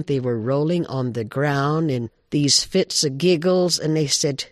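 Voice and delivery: ordinary delivery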